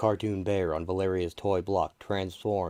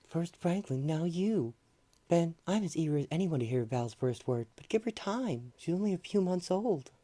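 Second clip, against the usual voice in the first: gentle woman voice; 10 semitones higher than usual. Acted. Sue's higher gentle voice.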